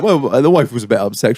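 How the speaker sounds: ordinary talk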